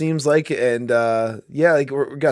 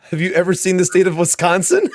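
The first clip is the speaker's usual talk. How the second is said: laughing through a mocking question; 3 semitones higher than usual. Real, not acted. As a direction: crack up while you mock the question. Mocking the courtroom exchange.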